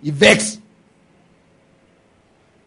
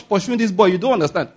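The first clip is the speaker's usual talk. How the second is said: ordinary talk, nothing marked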